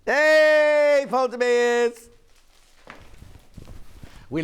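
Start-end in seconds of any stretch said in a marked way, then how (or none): 0.0-2.1 loud, high call across the studio. Real, not acted. Host teasing the colleague Paul Demers, calling out loudly. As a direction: hail him from a distance, high and loud.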